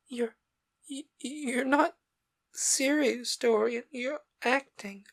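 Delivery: put-on high, halting, disbelieving girl's voice; Sybil, stunned. A narrator's reading. Sybil pleading with Dorian, halting and disbelieving. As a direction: falter as if the ground gave way.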